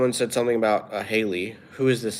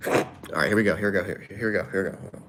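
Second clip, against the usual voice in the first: ordinary delivery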